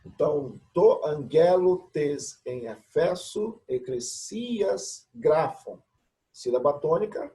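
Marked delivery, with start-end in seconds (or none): 0.7-5.8 slow, careful Greek reading; slow reading aloud. Shown as a demonstration. Teacher reading the verse for the class, slow. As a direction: read it out word by word. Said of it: "teacher reading Greek verse aloud".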